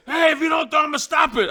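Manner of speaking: referee shout. Retold or imitated.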